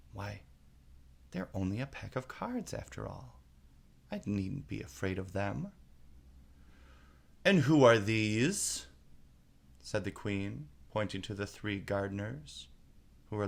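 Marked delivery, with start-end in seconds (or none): 0.1-5.7 to herself. A narrator's reading. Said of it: "she added to herself". 7.4-8.9 loom over the bowing figures; imperious queen. A narrator's reading. Queen demands who the gardeners are.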